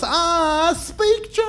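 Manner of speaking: high slow falsetto woman's voice; mock lady. Woman on the plane announcing she speaks German, high falsetto. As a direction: put on a prim lady falsetto. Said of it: "this woman goes".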